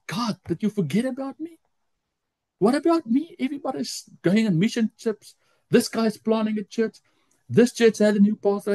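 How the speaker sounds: high-pitched, pleading complaint; retold protest to God, pitched high; frustrated. Missionary left behind complains to God, frustrated. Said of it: "got frustrated".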